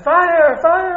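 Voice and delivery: high, loud cries of alarm, imitating his brother; retold scream. Teacher recalls his brother seeing the bed burn, retold scream. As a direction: yell it high like a child raising the alarm. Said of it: "I remember my brother screaming".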